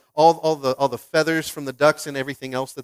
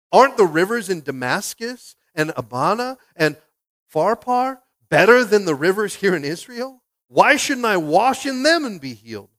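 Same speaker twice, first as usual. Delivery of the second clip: louder, higher scornful question; Naaman's outrage; 4 semitones higher, 7 dB louder than usual. Acted. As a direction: sneer at Israel's rivers. Naaman's rage acted.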